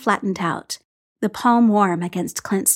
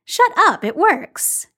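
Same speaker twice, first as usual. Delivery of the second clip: Clint's voice; 9 semitones higher, 3 dB louder, slower than usual. Acted. Clint's teasing retort.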